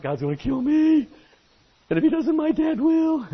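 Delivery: high, loud panicked cry; panicked kid. Retold or imitated. Boy fleeing the store after stealing gum, high panicked cry. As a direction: blurt it like a terrified kid.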